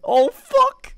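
loud, very high yelp; alarmed scream. Real, not acted. Player caught hiding on the roof, screaming in alarm.

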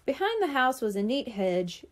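ordinary delivery

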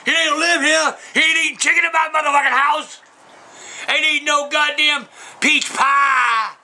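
high, shouted exasperation; angry rant. Real, not acted. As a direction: holler each denial, stretching the last words. Ranting about absent Waldo.

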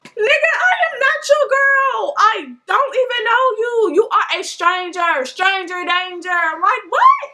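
high, slow, emphatic mock shout; indignant outburst. Rider protesting as stranger turns his phone to her, indignant.

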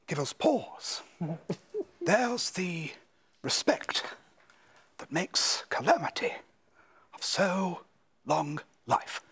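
halting celebrity impression with odd breaks mid-phrase. Impersonator reciting Hamlet as a well-known figure, celebrity impression. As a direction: put on a famous voice, stretching out the pauses. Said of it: "see if you can spot a few of those in amongst this".

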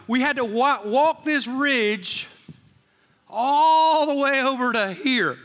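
preacher reliving the scary ridge walk, slow and loud; intense emphatic telling. Real, not acted. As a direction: stretch it out, reliving the fear.

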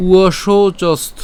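slow, loud "উয়শৌচস্থ", cut at the final t; demonstrated pronunciation. Shown as a demonstration. Teacher showing where to stop, slow emphatic "উয়শৌচস্থ". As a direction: draw out "উয়শৌচস্থ" and stop on the consonant. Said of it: "হসন্ত পর্যন্ত উচ্চারণ করব".

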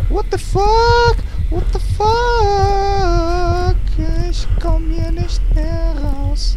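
whimper-shriek like you're being hunted; hammed-up terror, near crying; squealing panic.

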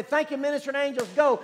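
rousing command. Shown as a demonstration. Models a command spoken to angels.

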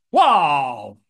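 a loud, amazed wow; excited wow. Retold or imitated. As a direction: burst out in delight at the offer. Reacting to friend's loan offer.

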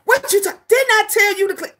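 mock mom scolding. Mom scolding kids in the hallway about cleaning. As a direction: snap at the kids like an angry mom. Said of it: "I be like".

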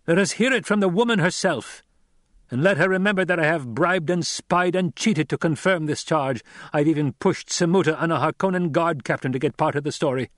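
high, heated accusation; accusing avenger. Acted. Captor accusing the woman he holds, heated. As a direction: press the charge against her. Narrator voices Gurney accusing.

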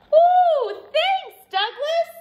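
very high, loud gushing thanks; delighted rabbit. A narrator's reading. Rabbit thanking Douglas for the hat, high delighted squeal. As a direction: squeal it with glee.

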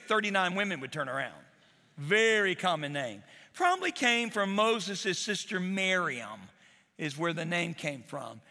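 ordinary delivery

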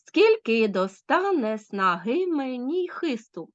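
slow waltz beat, first syllable of "Скільки" stressed; dactylic scansion. Shown as a demonstration. Teacher letting pupils feel the waltz, slow triple stress on "Скільки достане снаги". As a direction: stretch every third syllable starting on "Скільки". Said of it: "А відчули тут темп".

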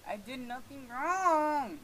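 high, loud, drawn-out whine; escalated, exasperated plea of innocence; wailing protest. Real, not acted. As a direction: wail it with WRONG stretched.

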